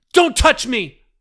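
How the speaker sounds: high, loud shout. A narrator's reading. Daughter recoils from father, loud shout. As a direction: snap away from his hand.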